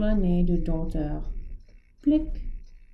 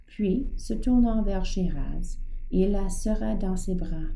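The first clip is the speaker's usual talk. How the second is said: plain reading voice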